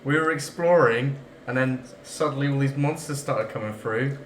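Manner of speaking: pleading stranger voice. Acted. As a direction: plead to be let in. Player voices survivor at door.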